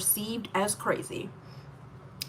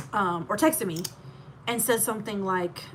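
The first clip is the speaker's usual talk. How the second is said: ordinary delivery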